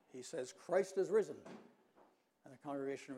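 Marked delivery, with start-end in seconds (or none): 0.5-1.4 louder proclamation. Retold or imitated. Rector repeating the Easter call to the congregation, louder. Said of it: "in a louder tone of voice".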